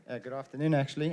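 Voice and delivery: usual speaking voice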